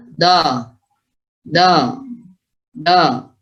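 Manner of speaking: contrasting "dha", right place but incomplete heaviness; demonstrated not-quite-right "dha"; dhad lacking its qualities. Shown as a demonstration. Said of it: "secara sifat belum ikut".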